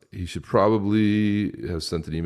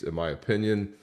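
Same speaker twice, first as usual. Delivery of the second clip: ordinary delivery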